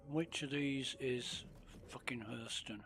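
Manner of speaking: whispered under the breath. Real, not acted. Gamer scanning the map, whispered curse. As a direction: mutter it to yourself unvoiced. Muttering while searching the map.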